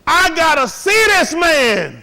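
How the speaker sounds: loud, high, drawn-out pulpit cry; full-force preaching climax; preacher's shout. Real, not acted. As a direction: cry it out slowly to the congregation.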